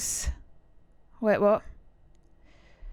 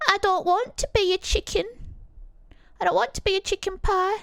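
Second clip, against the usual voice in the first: high-pitched mimicked Yorkshire voice; Yorkshire accent; 10 semitones higher than usual. Streamer quoting Chicken Run, high put-on Yorkshire accent. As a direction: quote the film high up in Yorkshire. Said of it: "that was a Yorkshire accent".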